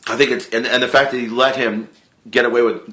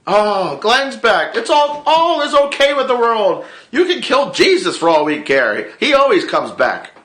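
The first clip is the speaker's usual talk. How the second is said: mock relief; 10 semitones higher than usual. Relieved fan cheering Glenn's return in high gushing voice. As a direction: gush like everything's fine now. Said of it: "I'm like".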